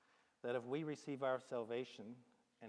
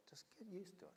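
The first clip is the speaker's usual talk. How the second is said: a sly whisper, no voice at all; whispered aside; 8 semitones higher, 12 dB quieter, faster than usual. Acted. Salesman coaxing the buyer, whispering. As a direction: lean in and whisper it like a secret tip. Salesman coaxes about the Hilux.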